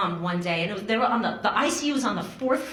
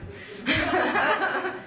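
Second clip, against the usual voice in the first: rising laugh; 8 semitones higher than usual. Real, not acted. Laughs at her own anger.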